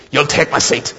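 lady who won't take no; imitated firm insistence. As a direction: make the offer an order, not a question. Lady insists after a refusal.

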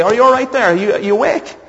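high-pitched rousing of someone passed out; mock concerned passer-by.

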